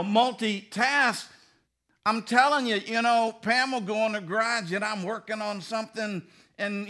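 ordinary talk, nothing marked

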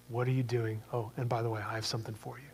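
soft, fading to near silence; tenderly, trailing off into a hush; gentle quiet Jesus. Acted. Jesus offering a mission, hushed and fading.

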